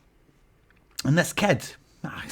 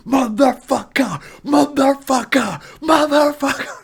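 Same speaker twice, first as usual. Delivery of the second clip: drawn-out furious yell climbing higher; enraged yell; 9 semitones higher, slower than usual. Retold or imitated. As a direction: yell it while strangling him. Strangling the fan in rage.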